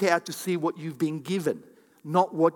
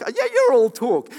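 higher, louder jeering retort; mocking accusation; 8 semitones higher, 8 dB louder than usual. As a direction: throw it back as a mocking taunt. Acting out people mocking his faith.

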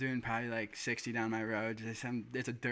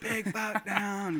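high, drawn-out mock holler; retold scream; 9 semitones higher, 3 dB louder, much slower than usual. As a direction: holler it out long and high, re-enacting the triumph.

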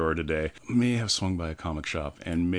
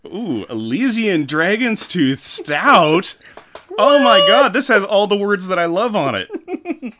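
loud, high delighted gushing; thrilled; 12 semitones higher, 10 dB louder, slower than usual. Real, not acted. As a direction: gush over the label. Thrilled at the beer label.

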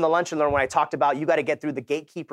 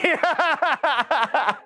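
much higher imitation of the loan officer's laugh; mocking laugh; 13 semitones higher, 3 dB louder than usual. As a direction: laugh at the kid like the officer did. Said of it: "he starts laughing at me".

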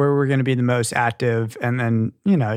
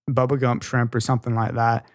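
normal talking voice

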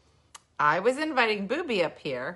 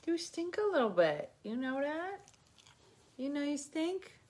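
high, teasing pet talk; 8 semitones higher, 5 dB quieter than usual. Real, not acted. Talking to Booby the dog, high teasing baby talk. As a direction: coo at the dog, much higher.

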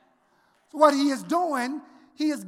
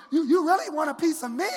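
fast, shouted dare as God; challenging God; 5 semitones higher, faster than usual. Acted. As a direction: taunt them like an angry God. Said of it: "God is saying".

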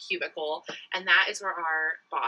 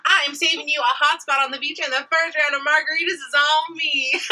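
higher, louder flirty phone opener; sexy sales pitch voice; 6 semitones higher, 5 dB louder than usual. Shown as a demonstration. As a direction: sell it like a flirty telemarketer. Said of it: "With the sexy voice?".

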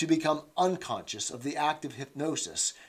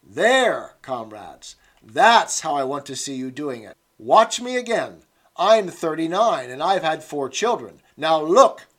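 loud demonstrating; 9 dB louder than usual. Acted. Instructress demonstrating toe touch to the comrades, loud. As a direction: show off the move to the squad.

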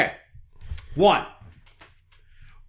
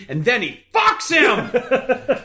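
loud laughing yell; laughing shout; 10 semitones higher, 3 dB louder, slower than usual. Real, not acted. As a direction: shout the punchline while laughing. Shouting joke punchline.

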